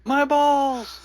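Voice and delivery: high, loud scream of pain. Acted. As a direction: shriek in agony. Character hit with excruciating pain.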